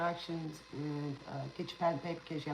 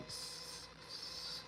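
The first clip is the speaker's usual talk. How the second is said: stutter; 12 dB quieter than usual. Real, not acted. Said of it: "I do stutter sometimes".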